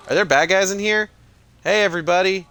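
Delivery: sudden loud, high, quick call into the game; excited outburst. Real, not acted. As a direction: burst out with excitement at the screen.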